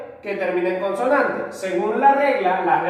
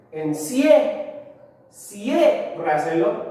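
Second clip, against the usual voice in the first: ciérraselo drawn out slowly, leaning on CIE; stress on first syllable; much slower than usual. Shown as a demonstration. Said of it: "ahí debería estar la mayor fuerza de voz".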